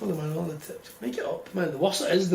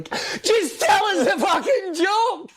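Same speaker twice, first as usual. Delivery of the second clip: exasperated outburst; 12 semitones higher, 4 dB louder, much slower than usual. Retold or imitated. Impatient listener in the joke.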